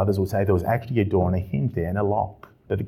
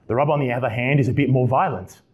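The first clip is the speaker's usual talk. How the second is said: normal talking voice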